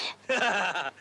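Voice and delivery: short dismissive laugh; scoffing laugh. Acted. Man dismissing his accuser's claim, scoffing laugh.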